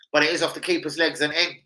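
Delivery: ordinary delivery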